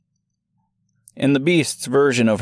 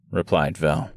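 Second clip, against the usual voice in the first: usual speaking voice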